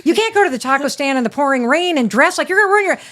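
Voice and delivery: a flustered mom objecting to the taco stand; frantic mom. Retold or imitated. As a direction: protest in a rush of alarm. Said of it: "I'm like".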